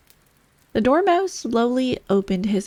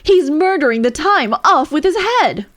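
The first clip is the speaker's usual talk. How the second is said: high, loud furious bawl; the Queen bellows across the concert hall; 8 semitones higher, 8 dB louder than usual. A narrator's reading. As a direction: roar it out like an enraged queen. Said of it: "bawled out".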